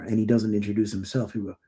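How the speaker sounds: ordinary delivery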